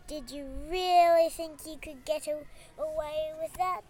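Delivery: usual speaking voice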